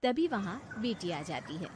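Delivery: ordinary talk, nothing marked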